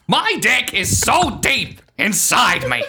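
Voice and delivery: high, slow squawking shout through laughter; Gilbert Gottfried voice. Acted. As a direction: screech it like a cranky comedian. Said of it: "Sometimes Gilbert just takes over".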